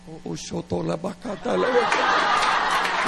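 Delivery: mock tongue-tied gibberish through a laugh; nervous gibberish. A nervous young lover to a date, laughing gibberish. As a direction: stumble over the confession, half laughing. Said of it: "They're like".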